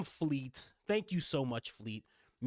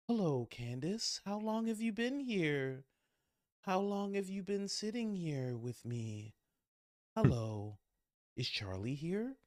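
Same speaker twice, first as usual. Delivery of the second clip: slow, higher, dreamy just-woken voice; like someone coming out of a trance; dazed Erica; 5 semitones higher than usual. Retold or imitated. Erica waking from a trance, asking Candace dreamily. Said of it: "She's like".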